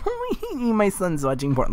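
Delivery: high-pitched mock parental reaction. Mocking how parents would react to a son, high-pitched mock parent. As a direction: put on a high, silly parent voice.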